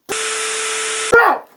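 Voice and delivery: high squeaky puppet cry. Pretend. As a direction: yelp like a tiny puppet. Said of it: "it's just a puppet".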